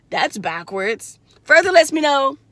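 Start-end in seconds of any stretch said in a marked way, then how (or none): 0.1-1.2 slow mocking drawl of his words; slow mocking quote of the client; his reply. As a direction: drag it out slowly to mock him. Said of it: "for him to say".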